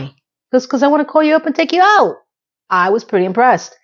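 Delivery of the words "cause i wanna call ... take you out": quick, higher, keen reply; eager suitor. Retold or imitated. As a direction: rush it out hopefully, pitched up. Acting the bartender's eager pitch.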